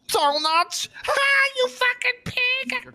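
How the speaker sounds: mocking shout. Mocking cop about donuts.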